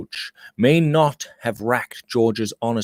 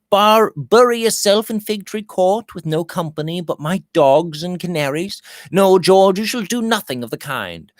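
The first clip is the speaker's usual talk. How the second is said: put-on breezy young gentleman, pitched higher; lively higher young man's voice; Robert's voice; 9 semitones higher than usual. A narrator's reading. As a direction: lift the voice for cheerful Robert.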